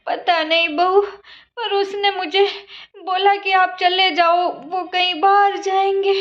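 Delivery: put-on slow, weary old woman's voice; a tired old mother explaining herself; meek old mother-in-law. Old mother-in-law pleading at door.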